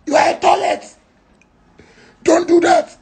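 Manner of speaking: high, mocking sing-song insult; high teasing jeer; shrill taunt.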